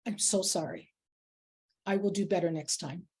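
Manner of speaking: low, soft, contrite apology; sincere apology. Retold or imitated. Senior person calling a colleague after misgendering them, sincere apology. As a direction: own the mistake quietly and earnestly. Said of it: "and I apologized".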